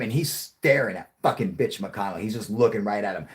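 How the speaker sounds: normal talking voice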